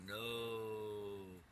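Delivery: a dark, otherworldly no; demonic no. Retold or imitated. Voice refusing the narrator's leaving, mock demonic no. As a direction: growl a single no like something old and evil. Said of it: "It sounded real demonic".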